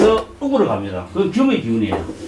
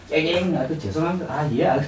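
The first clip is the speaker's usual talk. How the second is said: softer, meek mock whimper of a backed-down braggart; cowering apology; 7 dB quieter than usual. Loudmouth who backs down, giving a softer cowering apology. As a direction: shrink back and mumble sorry, much softer.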